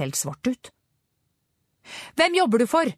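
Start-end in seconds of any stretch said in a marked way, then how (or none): 2.2-2.9 high, sharp interrogation; high-pitched confrontational shout; shouted demand. A narrator's reading. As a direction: demand an answer, pitch jumping up. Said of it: "ropte Angela".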